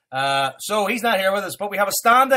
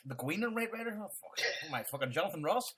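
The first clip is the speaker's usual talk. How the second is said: embarrassed mutter; 12 dB quieter than usual. Real, not acted. Muttering at own silly intro.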